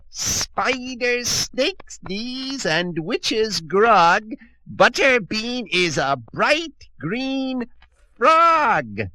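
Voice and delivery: high, slow witch incantation; hissed witch spell. Acted. Old Witch cursing Butterbean, high slow witch spell. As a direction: cast the curse slowly, savouring it. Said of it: "she hissed".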